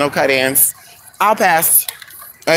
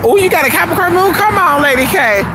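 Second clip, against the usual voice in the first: excited; 11 semitones higher than usual. Real, not acted. Excited reaction to viewer's sign.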